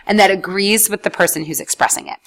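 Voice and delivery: ordinary delivery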